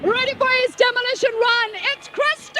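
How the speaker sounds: announcer. Acted. Host introduces contestant.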